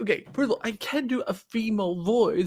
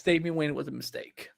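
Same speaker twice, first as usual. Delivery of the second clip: ordinary delivery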